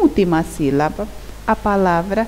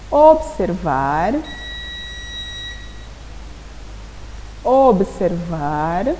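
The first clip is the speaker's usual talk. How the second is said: Observar drawn out very slowly; slow dictation; 3 dB quieter, much slower than usual. Shown as a demonstration. Teacher dictating an exercise word to pupils, very slowly. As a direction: dictate Observar syllable by syllable, stretching the ending.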